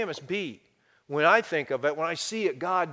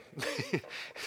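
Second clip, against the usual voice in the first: soft laugh; 4 semitones lower, 16 dB quieter than usual. Real, not acted. Laugh after voicing God.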